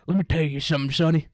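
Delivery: put-on loud folksy country-boy accent. Convincing classmate he's from the country, loud country accent. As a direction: proclaim it like a backwoods old-timer. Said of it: "make this guy think I'm from the country".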